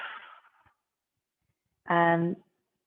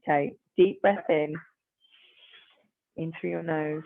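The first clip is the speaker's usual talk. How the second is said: steadying breath; 6 dB quieter, slower than usual. Acted. Leads a breathing exercise.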